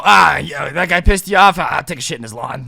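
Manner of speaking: loud, hammed-up neighborhood troublemaker; cocky friend impression. Friend touting lawn revenge, loud and mock-casual. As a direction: play the pal casually egging someone on.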